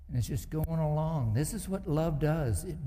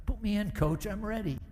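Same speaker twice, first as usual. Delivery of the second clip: eager plea; 8 semitones higher than usual. Retold or imitated. Bench player begging to play.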